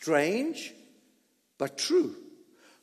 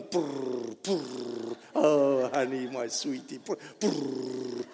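cooing pet talk; 7 semitones lower, 6 dB quieter, slower than usual. Retold or imitated. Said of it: "All your cat and dog goes".

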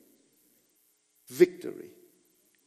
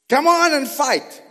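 higher, louder taunt; mock taunt; 10 semitones higher, 11 dB louder than usual. Preacher acting the enemy's taunt, louder. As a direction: call out the challenge.

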